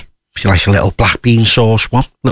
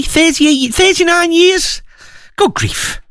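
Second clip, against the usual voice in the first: amazed; slower than usual. Real, not acted. DJ reacts to anniversary request.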